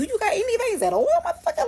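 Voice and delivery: incredulous squeal. Retold or imitated. Retelling frustration at the patient.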